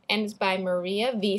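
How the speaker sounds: usual speaking voice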